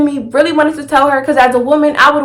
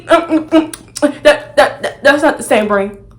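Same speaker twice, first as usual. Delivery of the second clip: mock stammer; slower than usual. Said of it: "He gave me the face again".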